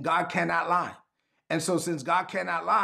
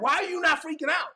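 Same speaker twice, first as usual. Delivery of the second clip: high-pitched, baffled onlooker; hammed-up disbelief, pitched high; mock incredulous; 11 semitones higher than usual. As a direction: imitate someone freaking out at your calm. Acts out panicking onlookers.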